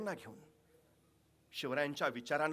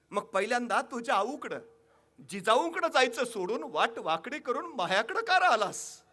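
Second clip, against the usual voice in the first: louder, higher rustic mother chiding the king; scolding mother; 7 semitones higher, 8 dB louder than usual. Acted. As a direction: chide him like an old village mother. (आईचा प्रेमळ दटावणीचा सूर)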